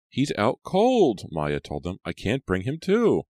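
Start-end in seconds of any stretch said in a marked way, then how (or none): 0.1-1.3 high, drawn-out alarm; distressed. A narrator's reading. Maya reports the teacher unconscious. 2.0-3.2 put-on high, anxious girl's voice; distressed. A narrator's reading. Maya panics over injured teacher.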